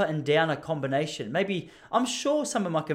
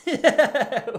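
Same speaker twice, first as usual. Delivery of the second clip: a real high laugh bursting out; burst of laughter; 8 semitones higher, 6 dB louder than usual. Real, not acted. The host cracks up at the banana joke, laughing. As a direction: laugh out loud at the punchline.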